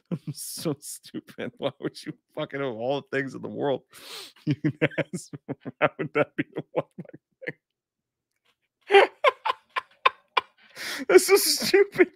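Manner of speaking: helpless giggles between the words; cracking up mid-sentence; breaking up laughing. Real, not acted. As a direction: barely get it out for laughing.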